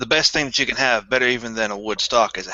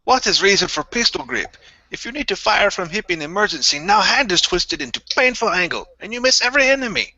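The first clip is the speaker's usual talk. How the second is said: hammed-up Russian accent, pitched higher and climbing; mock Russian accent; 9 semitones higher than usual. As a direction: play the indignant Russian soldier, voice rising higher to the end. Russian meme joke, rising voice.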